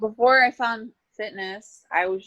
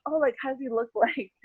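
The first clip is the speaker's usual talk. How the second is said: higher, lightly mimicked curious question; nosy people; 5 semitones higher, 4 dB quieter, faster than usual. People asking about an absent husband, mock-curious. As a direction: mimic a prying questioner with a laugh. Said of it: "And they're like".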